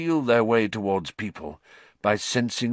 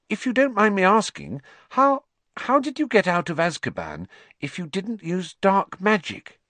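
put-on high, careful girl's voice; timid schoolgirl; timid Hermione; 11 semitones higher than usual. A narrator's reading. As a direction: ask shyly for a turn.

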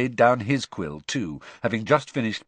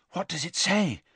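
breathless; 8 semitones higher than usual. Acted. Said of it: "Hermione asked breathlessly".

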